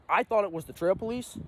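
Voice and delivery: ordinary talk, nothing marked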